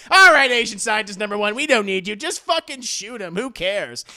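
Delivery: loud, careless order to get rid of him; mock dismissive shout. Filmmakers loudly dismissing the scientist, mock shout. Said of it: "It's like".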